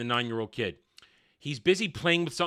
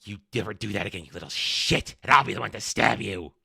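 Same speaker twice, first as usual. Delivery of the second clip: much lower, fast sneering threat; mock menacing threat; 7 semitones lower than usual. Princess threatening the kid, low mock menace. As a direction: growl it low like a bully. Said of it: "leans around and says".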